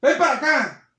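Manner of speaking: loud, high mock call; shouting a call. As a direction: bark it across the room. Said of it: "Hay gente que dice". (llamado gritando fuerte)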